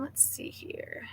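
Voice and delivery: whispered to herself; whispering. Real, not acted. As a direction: breathe it under your breath. Searching for stickers, muttering.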